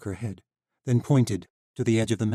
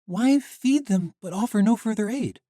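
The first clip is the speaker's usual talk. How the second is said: put-on young heroine voice; 13 semitones higher, 6 dB louder than usual. Misha questioning Sheva.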